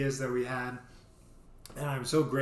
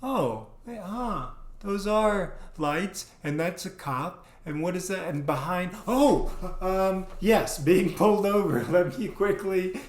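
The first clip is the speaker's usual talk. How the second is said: higher and louder, startled; flustered realization; 6 semitones higher, 4 dB louder than usual. Retold or imitated. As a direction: scramble as it dawns. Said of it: "And I'm like".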